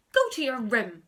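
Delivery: loud, firm command; angry order. Acted. Mum orders Henry to his room, loud and angry. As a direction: send him off with a loud order.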